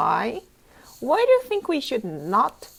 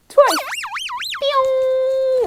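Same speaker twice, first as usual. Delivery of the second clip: high, loud "pew" zap; zap sound; 13 semitones higher, 3 dB louder than usual. Acted. Reader voicing the button's zap for a child. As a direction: fire off a zappy pew. Button pushed, effect noise.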